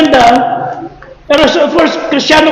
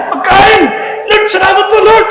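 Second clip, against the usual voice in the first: overjoyed thanks; 4 semitones higher, slower than usual. Retold or imitated. Starving man finds the food and thanks the Lord, overjoyed. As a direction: burst out with joy at the food.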